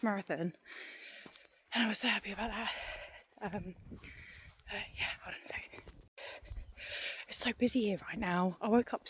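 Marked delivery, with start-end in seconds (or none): 3.4-5.8 hushed whisper aside. Real, not acted. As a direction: drop to a whisper. Pausing walk in busy spot.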